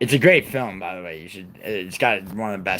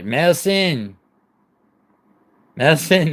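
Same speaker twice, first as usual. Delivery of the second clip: calling out; 8 semitones higher, 8 dB louder, much slower than usual. Real, not acted. Calling for his missing mod.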